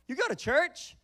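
high, loud incredulous question; can't believe you go to church; shocked disbelief. Retold or imitated. Teen friend reacting in disbelief, shocked loud.

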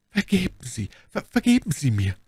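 halting, broken plea for forgiveness; stammered plea. A narrator's reading. Bonacieux begs Milady's forgiveness, stammering. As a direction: trip over the words, overcome. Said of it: "stammelte sie".

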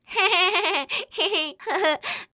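high taunting giggle; mocking laugh. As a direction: laugh at the silly monkey. (tiếng cười chế giễu)

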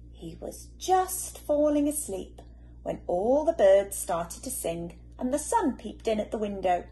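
ordinary delivery

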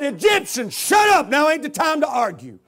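loud, high, shut him up; yelling him down. Retold or imitated. Preacher yelling at the tattooed man to shut up. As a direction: cut him off hard.

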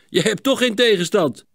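calling out. A narrator's reading. Gerben says Sietse has no opposition, calling out. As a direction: tease a friend over an easy race. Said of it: "riep Gerben".

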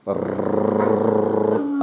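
rolling growl of a bad wolf. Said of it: "пришёл злобный серый волк". (рычание волка)

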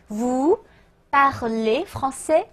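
French question drawn out, pause after vous; slow word-by-word model. Shown as a demonstration. Teacher building a question for learners, slow word-by-word. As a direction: separate each word slowly, rising at the end. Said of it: "请大家自己直接拼读啊".

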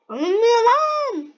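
slow, loud, exasperated groan of the words; frustrated outburst. Real, not acted. Gamer to the game when it won't work, frustrated and loud. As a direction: let the game's refusal boil over.

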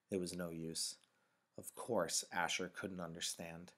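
usual speaking voice

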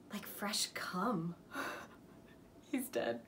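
slow, near-whispered aftermath; awed hush. Acted. Ending the monster's death scene, hushed and slow. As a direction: let it drop to a spent, breathy hush.

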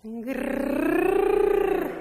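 high, loud rolled grrr; long purring grrr; cat purr growl. Shown as a demonstration. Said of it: "Let's try".

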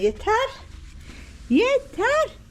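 high, loud plea of enough; mock exasperation. Owner has had enough of her cat, mock exasperated.

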